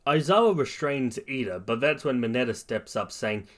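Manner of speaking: usual speaking voice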